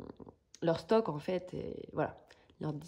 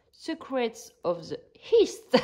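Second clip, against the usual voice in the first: slow English title in a French accent, East louder; 5 semitones higher, 7 dB louder, slower than usual. Real, not acted. French crafter reading an English kit title to viewers, slow, French-accented. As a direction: read the English words carefully with a French accent. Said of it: "Désolée pour mon accent".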